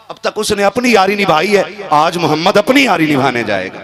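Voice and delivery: fervent preaching shout. Acted. Preacher repeats Prophet's words at full force.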